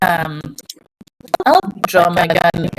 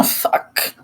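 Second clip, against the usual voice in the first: whispered curse; 8 dB quieter than usual. Acted. Sudden danger whispered.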